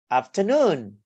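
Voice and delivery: "afternoon" stressed on NOON, swooping then falling; third-syllable stress. Shown as a demonstration. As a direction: hit the last syllable of "afternoon". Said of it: "primary stress on the third syllables".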